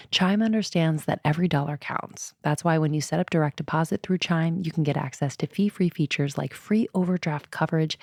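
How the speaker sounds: polished, personable ad read; scripted testimonial in an even announcing flow; host-read sponsor ad. Acted. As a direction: sell it like a podcast sponsor spot.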